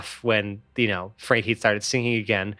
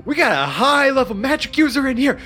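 high, stammering panicked guard; frantic guard; 7 semitones higher than usual. Acted. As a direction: rush out alarmed orders. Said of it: "the guards with more fervor".

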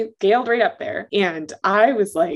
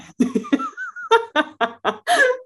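high laugh. Real, not acted. Listener laughing at joke.

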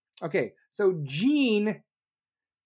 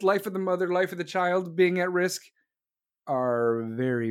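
normal talking voice

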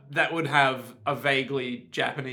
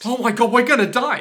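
loud, high pilot panic; hammed-up cry of impending doom; mock panic; 7 semitones higher, 8 dB louder than usual. Helicopter pilot about to crash, loud mock panic.

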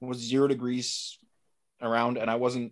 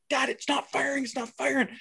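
much higher, panicky plea; anxious kid; 13 semitones higher than usual. Retold or imitated. Daughter pleading with dad as the gun fails, panicky high voice. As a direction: mimic a frantic kid whose gun won't fire. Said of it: "she's so anxious".

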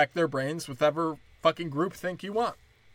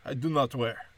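mock Russian accent. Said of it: "He was like".

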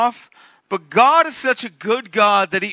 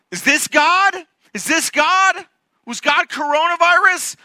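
high, loud challenge; impassioned questioning; 10 semitones higher, 5 dB louder than usual. Acted. Preacher questioning whether disaster is God, loud incredulous questioning. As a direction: fire off questions at a raised pitch. Rhetorical questions over earth shaking.

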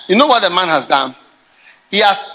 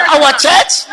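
mock indignant question; 6 semitones higher, 4 dB louder than usual.

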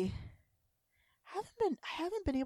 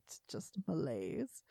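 ordinary talk, nothing marked

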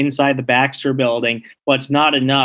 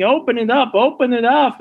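Captain America; 9 semitones higher than usual. Retold or imitated. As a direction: imitate a desperate yell at raised pitch without real volume. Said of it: "he's screaming".

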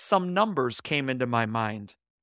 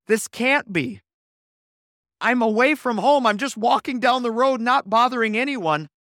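higher, louder, flustered and insistent; protesting a call he can't explain; 6 semitones higher, 4 dB louder than usual. Retold or imitated. Flustered man protests to operator.

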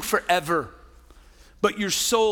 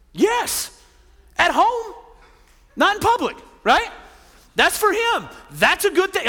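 higher, teasing, with a grin; playful excitement; 9 semitones higher, 4 dB louder than usual. Acted. Pastor teasing wives about dressing up at home, playfully high. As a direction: wink at the crowd, pitched up and bright. Cheeky aside on provocative clothing.

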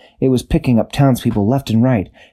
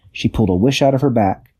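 ordinary delivery, nothing marked

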